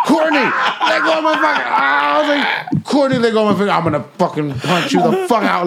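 high-pitched, frantic retold shouting; pained yelling. Friend re-enacts begging biter to let go, pained yelling. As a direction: plead and threaten in a high, hurting voice. Acts out yelling while being bitten.

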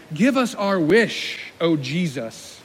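slow, grand mock invocation; mock wish to genie. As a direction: intone it as if summoning a genie. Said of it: "treat him like he is Ala-, the genie from Aladdin".

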